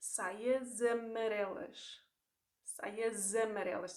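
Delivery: saias amarelas with the S linked as Z, saiazamarelas; linking Z sound. Shown as a demonstration. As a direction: slowly join saias amarelas with a Z. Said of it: "you get a Z sound instead".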